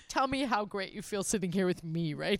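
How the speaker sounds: put-on self-important interviewer voice; imitating a TV host leaning back, smug put-on voice; Stephen Colbert. Lightly voiced smug host. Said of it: "leans back and he's like".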